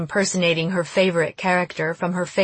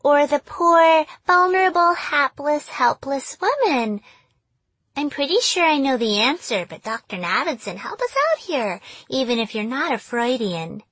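put-on baby doll voice; 7 semitones higher than usual. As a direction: coo it like a simpering doll. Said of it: "she spoke in a baby doll voice".

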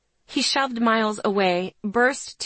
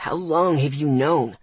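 through his teeth; 6 semitones lower than usual. Acted. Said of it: "asked through his teeth".

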